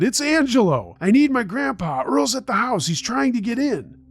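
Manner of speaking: high-pitched, panicked plea; shouted. A narrator's reading. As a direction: shout for help down the phone, desperate. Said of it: "I shouted".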